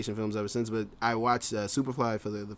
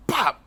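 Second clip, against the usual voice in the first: mom's sudden pop; 12 semitones higher, 5 dB louder than usual. Retold or imitated. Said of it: "she'd be like".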